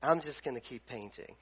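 low, soft Bob Ross calm; mock Bob Ross, low and soft. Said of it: "if we complained to Bob Ross, he'd be like".

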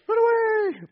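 a high, hammed-up yelp of fear; mock panicked cry. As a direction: squeal it like a fleeing coward. Mocking the scared soldiers fleeing.

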